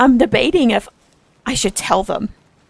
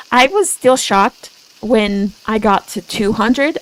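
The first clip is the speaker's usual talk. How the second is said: ordinary talk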